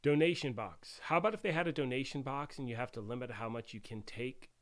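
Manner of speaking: normal talking voice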